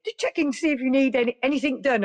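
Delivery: high, bright-toned cheery nurse; really jolly. Retold or imitated. Nurse offering to do anything, high and jolly. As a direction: keep the overly jolly nurse going. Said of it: "you know, really jolly".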